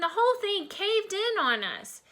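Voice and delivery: put-on high, excited kid's voice; explaining child. A narrator's reading. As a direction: tell the disaster high and urgent. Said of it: "Chipper explained".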